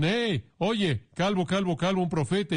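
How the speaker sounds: high taunting sing-song chant of calvo; jeering schoolyard taunt, voiced well above the preacher's own pitch; mocking youths. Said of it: "jóvenes dicen".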